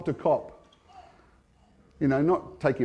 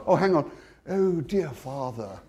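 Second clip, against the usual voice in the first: slow, put-on churchy reverence; mock pious prayer; 4 dB quieter than usual. Mocking the formal way to pray to God, slow and pious. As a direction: pretend to be properly reverent. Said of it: "So you spoke to God like that?".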